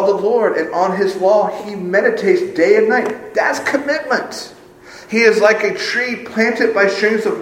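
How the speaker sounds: high, forceful sermon delivery; impassioned preaching. Real, not acted. Preacher declaring commitment to God to his congregation, impassioned.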